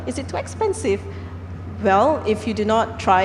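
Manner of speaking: normal talking voice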